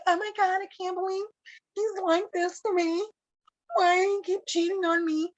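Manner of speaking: high-pitched imitation of a dramatic crowd; mock outcry. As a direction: whine it way up high, as others would. Acts out everybody's dramatic reaction.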